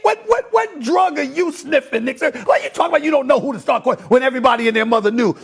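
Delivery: incredulous shouting rant; heated pundit debate. Real, not acted.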